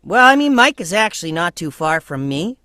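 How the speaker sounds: high, nasal character voice; Mike. Shown as a demonstration. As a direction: push it up into the nasal cavity. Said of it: "push him up into my nasal cavity".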